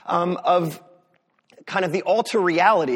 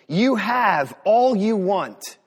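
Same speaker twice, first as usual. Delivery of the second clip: louder, drawn-out mock congratulation; sarcastic; 3 semitones higher, 5 dB louder, much slower than usual. Preacher voicing Paul's words to the Corinthians, sarcastic. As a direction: read it slowly with heavy sarcasm. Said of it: "Paul is being sarcastic here".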